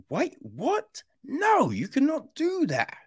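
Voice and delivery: high, incredulous protest; shocked disbelief. Retold or imitated. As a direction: squeak out a stunned objection. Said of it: "Do you like".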